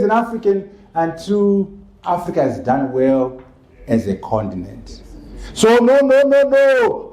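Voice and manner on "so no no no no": loud, high, punched repeated "no"; emphatic no. Acted. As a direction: hammer each "no" louder and higher. Emphatic rebuke to Makoni.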